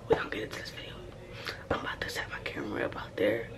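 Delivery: hushed unvoiced whisper. Real, not acted. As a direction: whisper so family won't hear. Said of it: "I'm whispering because people in here".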